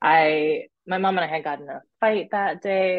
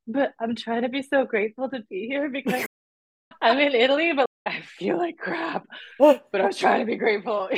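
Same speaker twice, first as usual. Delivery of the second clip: forced gratitude; 6 semitones higher than usual. Retold or imitated. Retold sick but grateful.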